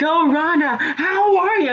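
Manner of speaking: very high, hammed-up hello; mock greeting. Shotzi greeting Ronda before she swings, mock greeting.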